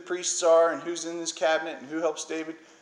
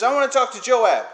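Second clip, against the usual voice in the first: retold shout; 7 semitones higher, 8 dB louder than usual. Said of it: "lady shouting from the wall".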